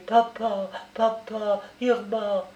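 mildly alarmed, plaintive calling; distressed calling out. Acted. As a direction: call out to loved ones who don't answer. Recounts calling to father and sister.

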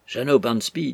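ordinary delivery

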